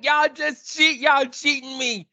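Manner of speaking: high-pitched wounded protest, played up; high, aggrieved mock whine; mock upset. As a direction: complain like you've been cheated, half joking. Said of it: "that's why I was mad, because I was like".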